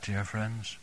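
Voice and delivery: ordinary talk, nothing marked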